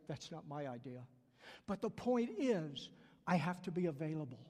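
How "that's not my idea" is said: softer, lower voice of God. Retold or imitated. God's reply quoted in sermon.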